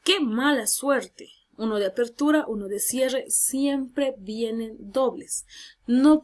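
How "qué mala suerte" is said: slow, emphatic exclaimed phrase; exclamation demo. Shown as a demonstration. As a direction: exclaim it slowly for the class. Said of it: "quiero exclamar".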